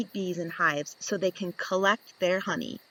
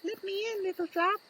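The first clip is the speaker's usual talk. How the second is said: high, softer little bee pleading; 11 semitones higher, 7 dB quieter, faster than usual. Acted. Bee asks the guard bee to let her in, high and soft. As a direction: ask nicely like a tiny bee at the door.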